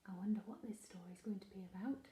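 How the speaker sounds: whisper. Acted. Reader's aside to listening children.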